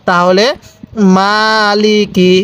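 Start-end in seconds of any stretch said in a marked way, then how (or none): 1.2-2.3 slow "মালিকি" with the first vowel drawn out; one-count madd. Shown as a demonstration. Teacher shows students how to read it, slow "মালিকি" with long "মা". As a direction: stretch "মা" in "মালিকি", no stretch on the last syllable. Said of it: "এরকমভাবে শিখব".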